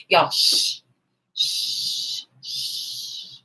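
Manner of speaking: soft unvoiced shh, fading out; whispered shushing. Real, not acted. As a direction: hush them in a whisper. Hushing the audience.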